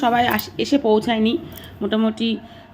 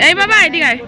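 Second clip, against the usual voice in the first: calling out; 6 semitones higher, 5 dB louder than usual. Real, not acted. Mother calling her son away from the water, raised call.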